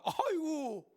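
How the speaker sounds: high, loud cry of amazement; startled exclamation. Acted. Onlookers seeing the dead man come out, startled. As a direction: let out an aghast "oh my".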